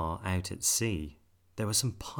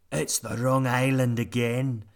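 louder, higher pirate grumble; grumbling pirates; 4 semitones higher, 6 dB louder than usual. A narrator's reading. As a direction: complain like fed-up pirates. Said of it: "they said".

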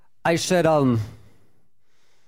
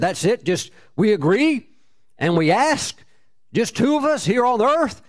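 halting questions lifting higher at each end; incredulous questioning; 8 semitones higher than usual. Retold or imitated. Tenant querying the simple Bible promise, incredulous. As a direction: check it in disbelief, phrase by phrase. Doubting how simple the prayer is.

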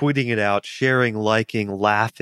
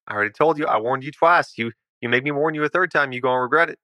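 higher-pitched, hurried warning; threatening recluse; 4 semitones higher, much faster than usual. Acted. Homeowner gives intruders a last warning, threatening. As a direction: warn them off with rising impatience.

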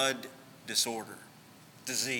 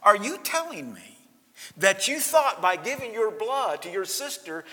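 higher and louder, mock disbelief; surprised doctor; 9 semitones higher, 7 dB louder than usual. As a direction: voice the doctor's amazed question, raised. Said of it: "And he says".

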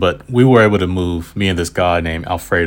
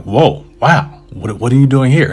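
put-on impressed kid; 6 semitones higher, 4 dB louder than usual. Boy impressed by writer answer.

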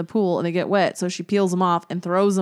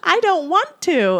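much higher, slow whining no; whiny kid refusal; 10 semitones higher, 5 dB louder, slower than usual. Retold or imitated. Mom voicing her daughter refusing, higher slow whine. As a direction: drag it out like a sulky girl. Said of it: "daughter's reply".

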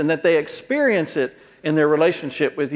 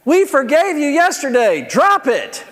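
put-on high, louder exasperated kid; kid in back seat; 11 semitones higher, 4 dB louder, slower than usual. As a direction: play an impatient little boy. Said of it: "One of the kids in the back seat goes".

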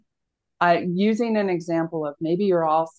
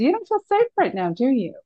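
suddenly high, fast and urgent; raised voice; 7 semitones higher, faster than usual. Shown as a demonstration. Presenter demoing an alarming voice to her audience, high and fast. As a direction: jump the voice way up and rush it to put them on edge. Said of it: "I raised my voice really fast".

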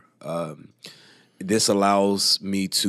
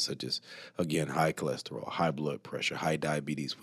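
usual speaking voice

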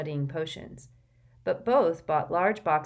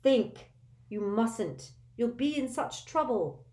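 urgent plea; 6 semitones higher, slower than usual. A narrator's reading. Hermione urgently restraining Harry.

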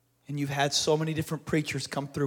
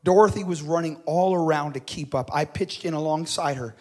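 ordinary talk, nothing marked

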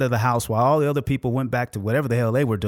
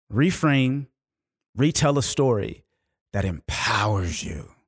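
low, slow, weighty delivery; deliberate motivational close, pitched well down; emphatic closing exhortation; 5 semitones lower, slower than usual. Real, not acted. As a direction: lean slowly on each key verb.

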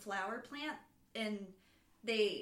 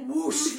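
loud imitated rush of air; big whooshing noise; helicopter whoosh; 4 semitones higher, 11 dB louder than usual. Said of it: "helicopter comes and is like".

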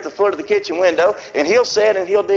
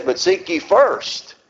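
slow, solemn reading of scripture; measured scripture reading; 5 semitones lower than usual. Acted. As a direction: read it out with weight. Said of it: "The Bible there says".

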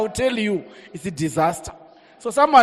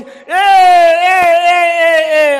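seductive call; 6 semitones higher, 6 dB louder, much slower than usual. Acted. Mocking Potiphar's wife's call.

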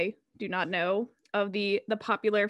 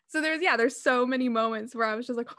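ordinary delivery

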